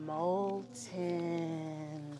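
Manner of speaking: very slow, stretched-out word; drawn-out syllables, sinking like a melting body. Shown as a demonstration. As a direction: let it ooze out as if melting. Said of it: "pretend to melt your body".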